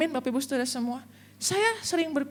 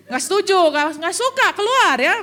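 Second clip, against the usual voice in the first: loud, higher mock outcry from the men; mock protest; 5 semitones higher, 8 dB louder than usual. Congregation men rejecting a woman pastor, loud mock protest. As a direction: shout it like indignant men walking out.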